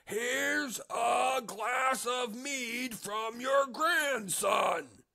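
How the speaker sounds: very slow, high and loud shout; full shout. A narrator's reading. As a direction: bellow each word at her. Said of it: "The innkeeper shouted his loudest".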